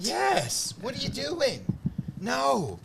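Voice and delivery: high-pitched incredulous scolding; exasperated disbelief. Acted. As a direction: plead with a clueless fan to stop. Acted exasperation at deluded fans.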